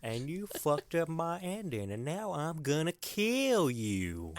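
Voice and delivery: slow, menacing put-on Shooter accent; the wronged writer threatening to kill Mort; Shooter's accent. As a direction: threaten him slowly in the villain's accent. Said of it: "I love his accent so much".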